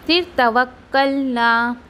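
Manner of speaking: normal talking voice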